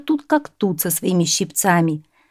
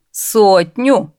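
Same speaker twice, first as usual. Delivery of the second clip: louder, startled question; narrated astonishment, voice raised; surprised; 3 semitones higher, 6 dB louder than usual. A narrator's reading. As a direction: echo it back in amazement. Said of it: "удивились сеньоры".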